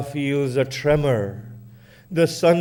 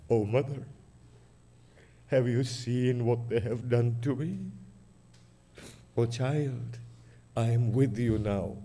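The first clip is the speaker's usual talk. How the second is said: much softer, lower grieving voice; hushed lament; 6 semitones lower, 7 dB quieter than usual. Acted. Hussein and his mother Fatima speak in a low soft lament.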